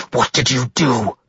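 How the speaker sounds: growled accusation; snarling. A narrator's reading. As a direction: snarl the question through held-back fury. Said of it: "he snarled at the beast".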